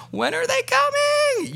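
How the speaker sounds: put-on be the kid pressed to the window; eager kids.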